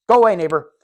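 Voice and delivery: loud, shooing go away; mock shout. Shooing away the noisy neighbor, loud.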